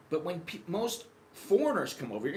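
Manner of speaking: usual speaking voice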